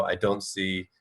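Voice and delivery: ordinary talk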